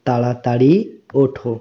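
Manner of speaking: ordinary talk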